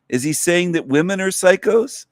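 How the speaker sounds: normal talking voice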